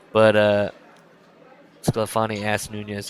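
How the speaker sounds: usual speaking voice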